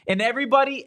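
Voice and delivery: ordinary talk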